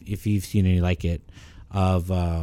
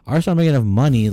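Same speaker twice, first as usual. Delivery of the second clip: "Artists aren't making enough money" mimicked higher, louder and fast; mocking repeat; 5 semitones higher, 6 dB louder, much faster than usual. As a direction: sneer it back as a mocking parrot. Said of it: "and I was like".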